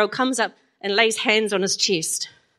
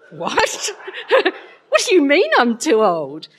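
incredulous, laughing through the words; a laugh bursting into a shocked retort; laughing disbelief; 6 semitones higher, 5 dB louder than usual. Retold or imitated. Mum to teasing daughter, laughing in mock outrage.

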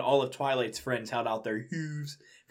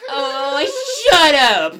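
shrieked, much higher and louder; embarrassed yell; 18 dB louder, much slower than usual. Acted. Tails yells at Max for teasing, a shriek. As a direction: scream it at the teaser.

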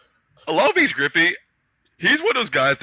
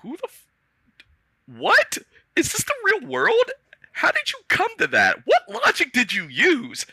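incredulous. Retold or imitated. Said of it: "I'm like".

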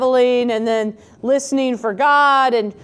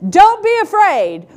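higher and louder call of reassurance; angel's greeting; 8 semitones higher, 7 dB louder than usual. Retold or imitated. Angel greets the frightened shepherds, higher and louder call. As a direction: call out like a heavenly messenger. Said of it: "This messenger of God shows up and says".

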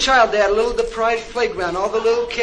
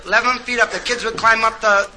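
ordinary talk, nothing marked

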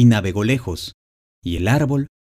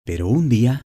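ordinary delivery, nothing marked

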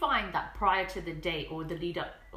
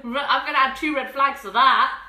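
high, loud indignant rant; mock outrage; 9 semitones higher, 9 dB louder than usual. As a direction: tear into him with comic fury. Cohost mock-rants at the retold date.

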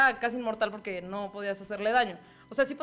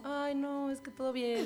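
put-on softer, dismissive young woman voice; lightly voiced Elena, played soft and casual; a soft, unbothered Elena brushing it off; imitating Elena; 4 semitones higher, 6 dB quieter than usual. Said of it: "si imitara a Elena sería como".

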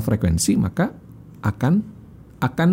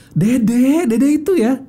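put-on high, raised-pitch scolding of a child; angry parent; 13 semitones higher, 3 dB louder, much slower than usual. Shown as a demonstration. Parent demo scolding little Dede, angry high intonation. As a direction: call the kid out crossly, pitched way up. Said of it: "kadang-kadang kalau lagi marah ya".

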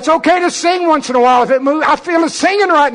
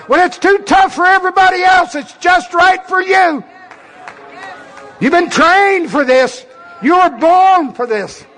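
shout; 4 semitones higher than usual. Real, not acted. Preacher firing up the crowd.

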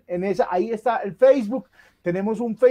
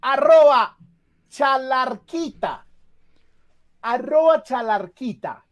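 announcer reveal; 4 semitones higher, slower than usual. Acted. Announcing winner name.

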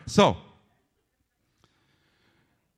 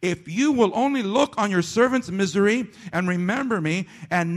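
raised, measured pulpit reading voice; scripture reading; 6 semitones higher, 3 dB louder than usual. Acted. Pastor reading Hannah's prayer to the church, raised and measured. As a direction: reads the verses out to the congregation.

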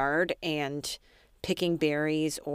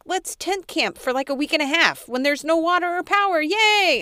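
high, louder, hammed-up cheer; mock-cheerful husband; 10 semitones higher, 8 dB louder than usual. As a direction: oversell the camping trip gleefully. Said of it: "And then he's like".

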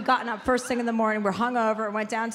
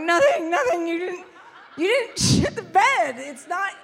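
giggling, high, half-breaking into laughter; laughing through denial; 7 semitones higher, 4 dB louder than usual. Pretend. Wife confessing the prank while laughing. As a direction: try to deny it while cracking up. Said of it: "I'm like".

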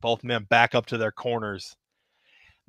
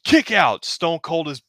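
much higher, louder, excited count; excited pin count; 6 semitones higher, 4 dB louder, slower than usual. Acted. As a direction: call the count like live commentary. Live pin count commentary.

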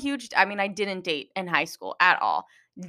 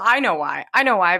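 normal talking voice

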